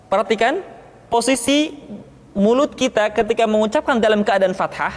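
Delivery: usual speaking voice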